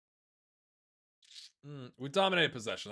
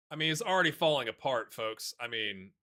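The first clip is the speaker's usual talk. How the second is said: ordinary talk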